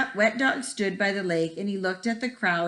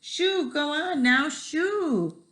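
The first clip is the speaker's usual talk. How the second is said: high shooing; 7 semitones higher, much slower than usual. A narrator's reading. Fancy people shooing the dripping dog in high voices. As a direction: shoo pitched way up.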